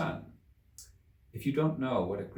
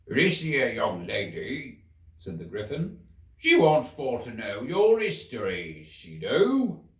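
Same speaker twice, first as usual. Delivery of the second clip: griffin; 4 dB louder, slower than usual. A narrator's reading. The griffin introduces Alice.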